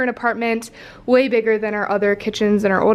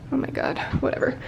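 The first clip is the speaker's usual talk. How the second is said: whispered aside; 2 semitones higher, 8 dB quieter, faster than usual. Real, not acted. Aside about running cat.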